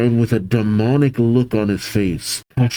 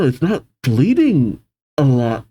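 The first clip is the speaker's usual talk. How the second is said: normal talking voice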